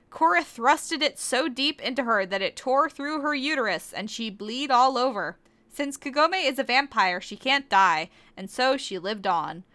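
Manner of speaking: ordinary talk, nothing marked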